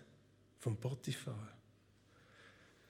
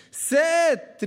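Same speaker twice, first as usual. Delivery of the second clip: high, loud cry for attention; calling out; 9 semitones higher, 7 dB louder than usual. Retold or imitated. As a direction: call the servants sharply. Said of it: "rief sie".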